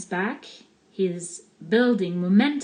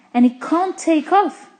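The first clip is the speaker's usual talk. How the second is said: ordinary delivery, nothing marked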